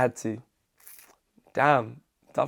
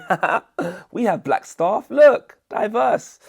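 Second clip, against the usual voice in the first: higher-pitched fake corporate cheer; mock company boasting; 7 semitones higher, 4 dB louder, slower than usual. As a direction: show off with phoney, exaggerated pride. Mocks companies showing off diversity.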